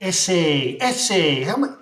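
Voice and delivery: hammed-up Mexican accent. Streamer mimicking a Mexican asking about tattoos, mock Mexican accent. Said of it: "She's Mexican".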